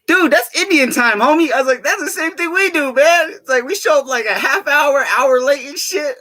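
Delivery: high, loud, animated exclamation; hammed-up excitement at a shared joke; excited retelling. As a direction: pitch way up, hollering to a buddy. Retells his excited reply to friend.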